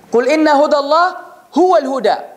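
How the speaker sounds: fast, chanted Quranic recitation. Acted. Preacher recites the verse to his audience, chanted. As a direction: recite the verse in one flowing breath.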